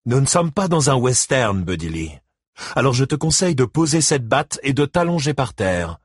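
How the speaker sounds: lightly voiced smug husband; smug sneer. A narrator's reading. Gérald smugly lectures Buddy Lee over the loudspeaker, a hint of sneering. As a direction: talk down to him with a smirk. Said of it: "sourire narquois".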